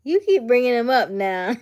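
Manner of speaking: loud, high laughing tease. Real, not acted. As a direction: tease her through laughter. Laughing teasing about baby.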